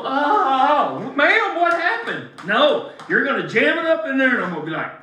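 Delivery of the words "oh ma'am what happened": mock wimp. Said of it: "make my head go".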